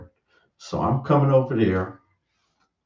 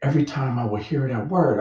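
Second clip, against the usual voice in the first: ordinary talk, nothing marked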